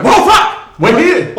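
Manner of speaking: high, loud excited outburst; re-enacted fan freak-out, far above normal pitch; retold thrilled reaction. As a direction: blurt it out in amazed excitement. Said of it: "I literally just went".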